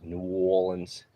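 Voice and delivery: slow, rounded New Orleans accent. Shown as a demonstration. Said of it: "a more of a round".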